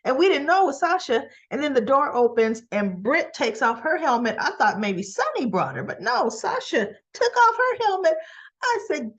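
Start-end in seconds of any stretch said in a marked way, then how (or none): none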